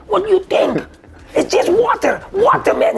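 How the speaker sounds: high, loud exasperation; shouted protest. Retold or imitated. Washer arguing it is just water, high loud protest.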